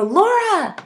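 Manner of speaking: high called-out name across a lobby; calling out. Retold or imitated. Calling to a woman across the hotel lobby, high call. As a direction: call her name from far off, high and bright.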